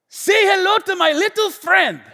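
loud, high mock Cuban-American accent; bad Cuban-American movie gangster. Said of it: "a really bad Cuban American".

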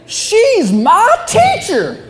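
put-on slow, high, wobbly drunk voice; sway the words like a tipsy man; glassy-eyed drunk at the bar in slow wobble; drunken wobble. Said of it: "in kind of a wobble, kinda like this".

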